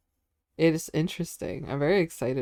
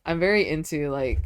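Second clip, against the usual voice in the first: normal talking voice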